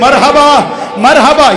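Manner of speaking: ordinary delivery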